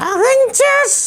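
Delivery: put-on puppet boy voice. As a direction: play the cheeky little boy puppet. Puppet names hero.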